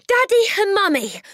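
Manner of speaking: loud high call; bursting out breathless. A narrator's reading. Matilda bursts out to her parents, shouting. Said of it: "Matilda burst out".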